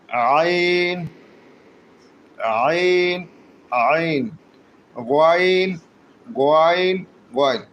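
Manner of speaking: "আইন" and "গয়ন" drawn out slowly; repeated "আইন", "গয়ন", stretched, last take shorter; letter-name drill. Shown as a demonstration. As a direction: model "আইন" and "গয়ন" with long vowels for learners. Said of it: "একটু শিখে নেই".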